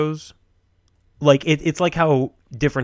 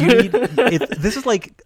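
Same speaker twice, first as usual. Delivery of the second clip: laughing; 8 semitones higher, slower than usual. Real, not acted. Laughing while explaining.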